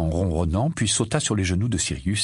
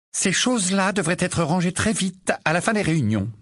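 higher, brisk and sharp mother; curt; 9 semitones higher than usual. Acted. Mrs Wesley scolding about the papers, curtly. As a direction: snap it out briskly. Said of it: "dit-elle sèchement".

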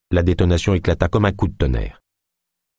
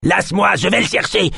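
high-pitched struggling yell; 13 semitones higher than usual. A narrator's reading. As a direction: howl it while fighting to break free. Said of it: "et se mit à hurler". (hurlé d'une voix aiguë)

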